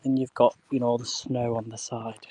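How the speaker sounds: ordinary delivery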